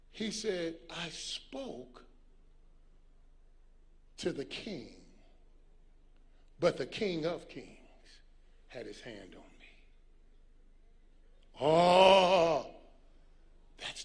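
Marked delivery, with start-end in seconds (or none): 0.9-9.7 hushed scripture quote. Acted. Preacher retelling verse for effect. 11.6-12.7 loud, long moan of awe; heartfelt preacher's exclamation; preacher's drawn-out "oh". Acted. Preacher reacts to the verse.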